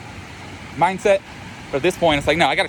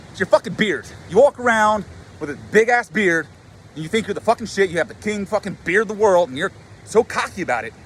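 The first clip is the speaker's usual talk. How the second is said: higher-pitched mock angry rant; acted mad accusation; 5 semitones higher than usual. Imagined other guy resents his beard, mock angry accusation. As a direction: play the guy in your head laying into you. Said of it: "Why are you mad at me?".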